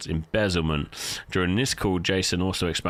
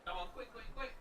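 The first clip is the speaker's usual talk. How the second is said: very high, quiet hurried voice; tiny high voice rushing someone; high squeaky urging; 12 semitones higher, 19 dB quieter, faster than usual. Acted. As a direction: squeak at them to hurry. Game voice urging quickly.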